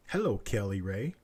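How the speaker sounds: normal talking voice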